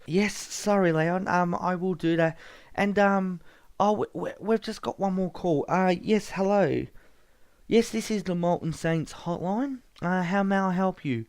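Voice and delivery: a nervous clerk handling a caller; Ben Tover's voice. Acted. Ben takes one more call.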